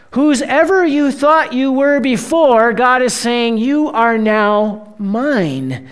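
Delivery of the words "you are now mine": very slow, tender and intimate; God claiming his people, slow and tender; tender divine claim. Acted. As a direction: say it like a gentle vow. Voicing God's claim slowly.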